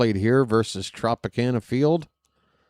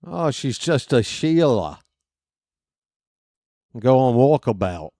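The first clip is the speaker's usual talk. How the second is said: bad Australian accent. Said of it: "My Australian accent is crap".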